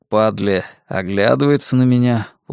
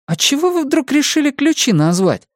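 curious; 13 semitones higher than usual. A narrator's reading. Pat asks Лёня about the keys, high and curious. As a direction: pipe up high, full of curiosity. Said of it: "с любопытством спрашивает Пат".

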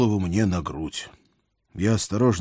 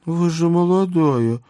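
cartoon bird; 9 semitones higher, 4 dB louder than usual. A narrator's reading. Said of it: "чирикнула Василиса".

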